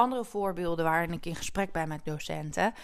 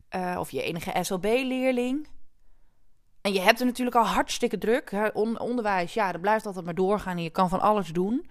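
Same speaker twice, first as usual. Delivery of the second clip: usual speaking voice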